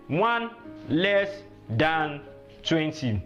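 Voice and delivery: word by word, with pauses; slow teaching gloss. Shown as a demonstration. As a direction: space out each word for learners. Teacher slowly glosses number's meaning.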